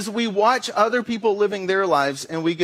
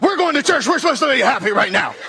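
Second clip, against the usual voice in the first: high, loud, fast snapping at the family; losing it in the car on the way to church; frazzled yell; 5 semitones higher, 4 dB louder, faster than usual. Retold or imitated. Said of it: "and you're like".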